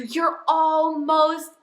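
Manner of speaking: loud, high, drawn-out cheer; crowd's cheering yell. Retold or imitated. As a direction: yell it out to spur on a runner. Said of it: "they start screaming at you".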